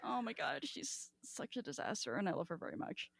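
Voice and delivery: normal talking voice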